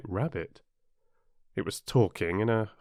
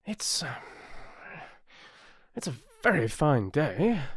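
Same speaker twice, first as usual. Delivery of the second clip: hesitant, higher, faltering small talk; timid voice; 5 semitones higher than usual. A narrator's reading. Timid voice at Alice's side, hesitant small talk. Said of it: "said a timid voice".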